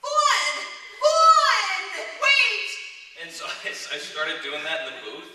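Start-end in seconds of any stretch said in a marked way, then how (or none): 0.0-2.7 put-on shrill-high obnoxious kid yell, much higher and louder; high obnoxious kid screaming a name; Yuri Lowenthal impression. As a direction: scream it like a bratty twelve-year-old. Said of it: "do my best Yuri Lowenthal impression".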